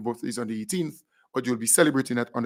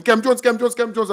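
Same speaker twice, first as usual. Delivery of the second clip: imitating people calling out; 9 semitones higher, 6 dB louder than usual. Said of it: "Everybody calling me".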